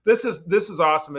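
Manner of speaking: ordinary talk, nothing marked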